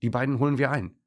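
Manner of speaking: rally the others to catch up; calling out. A narrator's reading. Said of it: "ruft Trixie Dackel".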